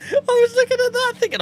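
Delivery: high, breaking laugh of relief. Real, not acted. Streamer laughing at misreading his balance, high laugh. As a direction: laugh it out, voice squeaking up.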